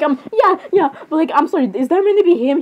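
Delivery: ordinary talk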